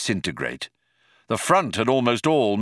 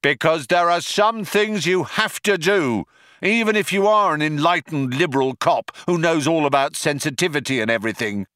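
emphatic high start easing down; a hint of a self-justifying cop; lightly voiced earnest cop; 7 semitones higher than usual. Cop explains himself to Trillian, calling out.

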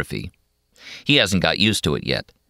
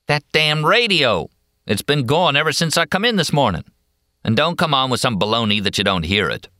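irritated cowboy; 9 semitones higher than usual. Acted. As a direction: gripe about the racket. McMurphy complaining.

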